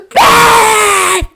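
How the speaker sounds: loud, high shout of a name. Retold or imitated. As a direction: yell her name across the room to startle. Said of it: "they're just like".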